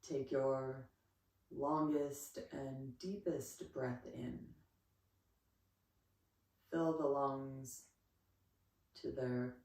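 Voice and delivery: slow, unhurried yoga breathing cues; calm meditation pacing; guided relaxation. Real, not acted.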